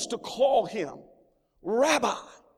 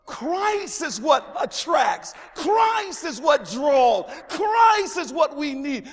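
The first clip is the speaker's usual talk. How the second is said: slow, loud, higher proclamation; preaching at full force; 4 semitones higher, 4 dB louder, slower than usual. Acted. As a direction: hammer each Christ home. Preaching climax, repeated.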